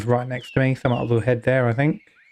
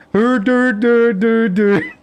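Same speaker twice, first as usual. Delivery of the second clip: high, loud sing-song do do do; teasing mock voice; 6 semitones higher, 4 dB louder, much slower than usual. Streamer mimicking a viewer, high teasing sing-song. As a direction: tease a friend with a silly high voice. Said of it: "I'll make her sound like you".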